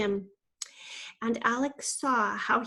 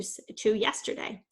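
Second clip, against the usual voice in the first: usual speaking voice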